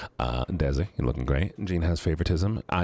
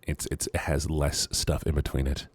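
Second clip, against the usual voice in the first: normal talking voice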